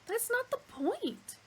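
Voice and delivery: high, slow exasperated protest; insist, exasperated. Acted. Arthur protesting to Zaphod, exasperated. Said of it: "Arthur protested".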